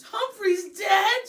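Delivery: put-on high, loud, slow little girl's sob; girl bursting into tears over lost hamster, sobbing; sobbing child. A narrator's reading. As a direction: wail it through tears like a small girl. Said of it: "she sobbed".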